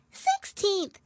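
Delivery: very high little Dormouse. A narrator's reading. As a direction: squeak it in. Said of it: "added the Dormouse".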